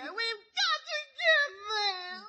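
slow, high, wailing plea; fake crying. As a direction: stretch the sob out to win him over. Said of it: "Just stop crying".

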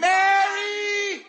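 loud high cry of her name; exasperated shout. Acted. Fred cutting off his nagging wife, exasperated shout. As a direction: cut her off sharply.

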